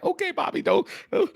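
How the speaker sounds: high-pitched, hesitant acted protest; pleading mock caution. Onlooker trying to restrain coach Bobby, high pleading voice. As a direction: trail off high, as if talking someone down. Acts out nervous reaction to coach's boasts.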